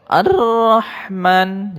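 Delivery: slow chanted tilawah; Quran recitation. Shown as a demonstration. Teacher chants the verse for pupils to repeat. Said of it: "Ayat ketiganya".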